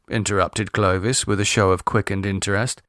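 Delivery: usual speaking voice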